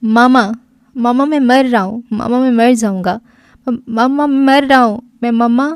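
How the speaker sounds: a son calling his mother in a fever of panic; delirious calling. A narrator's reading. Son face-down on the bed, calling his mother in delirious panic. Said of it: "ہذیانی انداز میں ... اپنی ماں کو آواز دے رہا تھا".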